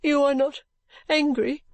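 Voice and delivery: high, tearful, timid question; sobbing. Acted. Daughter sobs as she asks her mother if she is angry, high and tearful. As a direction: ask fearfully through sobs. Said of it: "sobbed poor Caddy".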